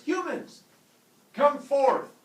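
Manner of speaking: loud, high god's call; booming summons. Acted. Prometheus summons humans on the beach, booming call. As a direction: call the humans forth like a mighty god.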